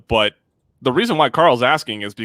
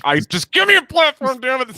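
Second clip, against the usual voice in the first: mock pent-up rant; 13 semitones higher than usual. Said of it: "It's like".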